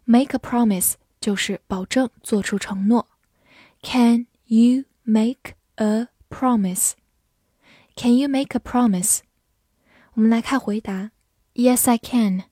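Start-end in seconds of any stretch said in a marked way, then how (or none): 3.8-6.9 slow, careful reading pace. Shown as a demonstration. Teacher reads example slowly for learners, slow. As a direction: stretch out each word slowly.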